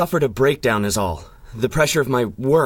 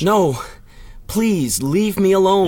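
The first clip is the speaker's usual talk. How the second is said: rising, desperate plea; distressed begging; 4 semitones higher than usual. Acted. Broken scientist begs the masked hero, desperate. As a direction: plead to be left alone.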